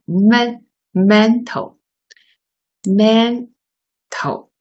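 "men-，mental，mental" broken up slowly, first syllable men stressed; teacher drills "mental" syllable by syllable, stress on "men". Shown as a demonstration. Teacher showing students the stress, "mental" slowed with men stressed. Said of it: "因为这个重音在这里哦".

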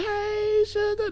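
high falsetto "Hey, sugar"; sugary falsetto greeting. Acted. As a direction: coo it up high. Signature greeting to the chat.

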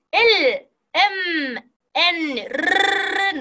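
"L、 M、 N、R" drawn out with a humming ring; resonant voiced consonants. Shown as a demonstration. Teacher giving examples of voiced consonants, "L、 M、 N、R" long and resonant. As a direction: hold each of "L、 M、 N、R" so it vibrates. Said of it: "声帯が振動して響いてる".

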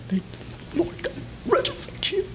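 strained, breath-only whisper; acted whisper. Abraham silently reassuring his son, strained whisper. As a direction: mouth it with barely any voice.

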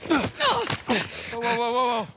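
high, loud volley of whoas; alarmed. Acted. Man to armed group as guns come out, alarmed. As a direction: urgently try to halt everyone. Guns drawn, calming a standoff.

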